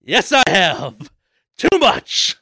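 slow, high, loud mock admission; hammed-up guilty confession. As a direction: own it with comic shame. Joking confession about games.

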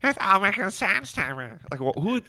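high prim nerdy voice; teacher's pet voice. Pretend. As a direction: play the smug front-row kid lightly. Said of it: "sounds like a teacher's pet sitting in the front row".

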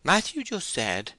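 high, frightened, slow blurting; terrified. A narrator's reading. Timid man passing blame to Matthew, terrified. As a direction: nervously shift the blame in a high voice. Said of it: "trembling and blushing with terror".

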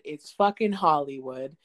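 ordinary talk, nothing marked

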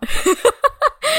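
a squealing high burst of laughter. Real, not acted. Laughing at how much the movie hates Republicans, a high squeal of a laugh. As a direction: let a delighted laugh squeal out.

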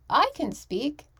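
high, perky mock boast; a hint of a clueless newcomer; mock naive confidence. Untrained newcomer boasting to casting directors, high mock. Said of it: "this person who's never done it says".

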